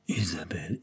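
whispered sighing name; whispered Isabelle, sighed like a lover. A narrator's reading. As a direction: breathe the name in a soft whisper. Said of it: "il soupira".

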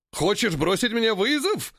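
indignant, high ape challenge. Acted. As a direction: bristle at the insult. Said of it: "возмутился вожак". (возмущённый вызов)

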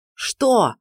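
sharp cry of disbelief; near shout. A narrator's reading. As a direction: nearly yell it. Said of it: "практически вскричал Даркхан".

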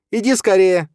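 loud, high-pitched yell; urgent shout. A narrator's reading. Stepmother clutching the baby calls her husband, urgent shout. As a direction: cry out for someone to hurry. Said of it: "крикнула Мацоха".

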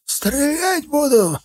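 put-on very high, drawn-out old watchman; slow, high-pitched old man's threat. A narrator's reading. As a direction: challenge intruders in a high elderly voice. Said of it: "раздался старческий голос".

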